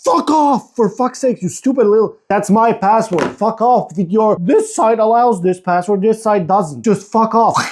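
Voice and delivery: high-pitched exasperated rant; pissed off. Real, not acted. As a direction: curse out the phone in frustration. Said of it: "I'm so pissed".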